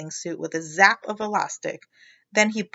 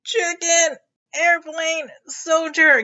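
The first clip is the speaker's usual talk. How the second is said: higher, louder breathless gasping; gasped; 8 semitones higher, 5 dB louder, slower than usual. A narrator's reading. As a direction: gasp it out like you're sinking. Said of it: "gasped Froggy".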